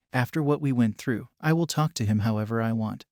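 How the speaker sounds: ordinary delivery, nothing marked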